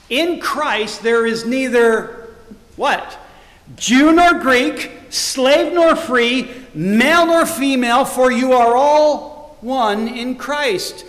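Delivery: loud, slow, high scripture proclamation; ringing pulpit delivery, stretched words; preaching at full force. Acted. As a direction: proclaim each pair slowly and loudly.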